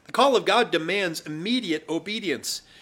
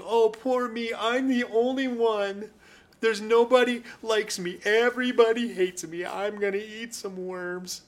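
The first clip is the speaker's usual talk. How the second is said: higher, sulky mock whine; whiny pity party; 7 semitones higher than usual. Preacher mimicking Elijah's self-pity as a sulky, higher whine. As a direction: play a sulking child feeling sorry for himself. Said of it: "having this little pity party".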